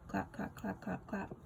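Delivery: soft mock clapping. A narrator's reading. Quiet mock applause.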